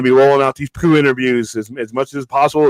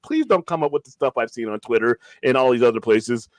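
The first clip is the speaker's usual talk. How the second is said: ordinary talk, nothing marked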